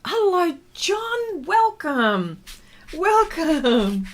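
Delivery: high bright sing-song hello; welcoming a viewer with a lifted voice; excited greeting. Real, not acted. Greeting live stream viewer warmly.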